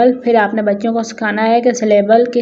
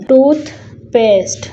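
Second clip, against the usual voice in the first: "Toothpaste" broken in two, a pause after tooth; split into syllables; 3 semitones higher than usual. Shown as a demonstration. Teacher showing pupils the syllables in a word, split into syllables. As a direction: say "Toothpaste" as two separate beats. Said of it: "this word has two syllable".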